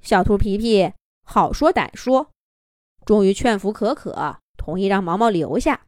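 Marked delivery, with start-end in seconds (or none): none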